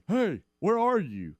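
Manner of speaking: lightly voiced son calling; son's voice. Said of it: "calls me".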